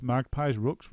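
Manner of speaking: ordinary delivery, nothing marked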